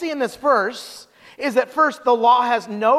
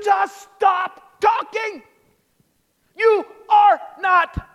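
high, slow shout, word by word; hammed-up fed-up yell, every word punched; exasperated shout; 10 semitones higher, 3 dB louder, much slower than usual. Preacher as Paul silencing the self-righteous, exasperated shout.

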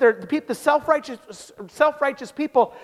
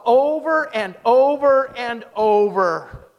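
each OVER drawn out and hit hard; slow, hammering stress on every OVER; emphatic repetition; much slower than usual. Retold or imitated. Preacher driving home how often you broke the law, emphatic.

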